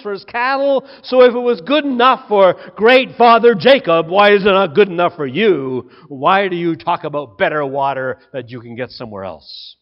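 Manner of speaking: loud, mocking retort that shrinks to a dismissive jab; indignant sarcasm. Samaritan woman mocking the rabbi at the well, sarcastic. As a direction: scoff at his offer of living water. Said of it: "she becomes indignant and sarcastic".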